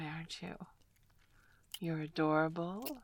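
ordinary delivery

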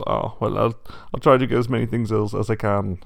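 put-on loud German-style accent, a little Austrian strongman; Arnold Schwarzenegger. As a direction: drift into a thick Germanic accent. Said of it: "he's going a little Arnold Schwarzenegger".

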